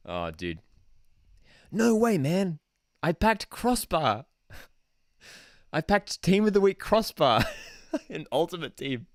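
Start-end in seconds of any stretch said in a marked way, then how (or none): 1.7-9.1 high, drawn-out fake astonishment; mock amazed gamer. Gamer packing Team of the Week crossbar, mock amazement. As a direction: play a thrilled pack opener, breaking into laughter.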